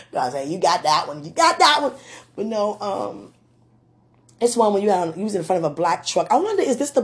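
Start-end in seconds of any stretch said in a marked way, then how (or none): 1.2-1.9 high, louder exclaimed retort; emphatic repeat. Retold or imitated. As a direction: throw it back higher and louder. Repeats God's answer, exclaiming.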